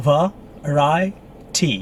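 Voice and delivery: "Variety" very slowly as va-RAI-tee; syllable by syllable. Shown as a demonstration. Teacher teaching the next word, syllable by syllable. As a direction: break "Variety" into separated beats. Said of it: "With the stress, second syllabus".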